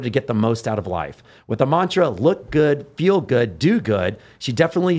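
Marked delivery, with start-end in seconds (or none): none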